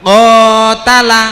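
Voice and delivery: "qatala" drawn out loud, with the ka held long; long vowel demo. Shown as a demonstration. Teacher quizzes pupils on the long vowel in qatala. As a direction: stretch "qatala" so the long a is heard.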